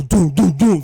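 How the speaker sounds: loud, booming stomp noises; mimicked marching thuds, punched hard; imitated heavy footsteps. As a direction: pound out each footstep with the voice. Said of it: "and you hear fucking doom".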